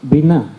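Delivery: Biná said bi-NÁ, stress on the last syllable; Biná, acento en la A final. Shown as a demonstration. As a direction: finish Biná on a firm accented A. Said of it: "acento en la A al final".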